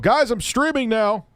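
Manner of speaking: high, slow, loud announcement; loudspeaker announcement. Pretend. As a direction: boom it out like a speaker in every home. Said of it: "have a loudspeaker in everyone's home".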